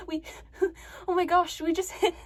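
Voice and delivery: high-pitched, acted hysterical giggling; crazed nervous giggles breaking up the words; panicked nervous laughing. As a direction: laugh helplessly through a horrified confession. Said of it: "and I start laughing".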